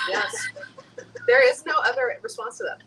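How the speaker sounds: bursting yes, high and loud; excited. Real, not acted. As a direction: gush an eager yes. Enthusiastic agreement to scalp massage.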